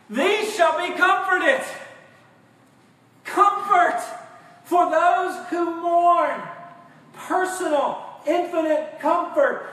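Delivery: full-force preaching. Real, not acted. Preacher to congregation, proclaiming comfort in a slow loud high voice. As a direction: ring out the promise.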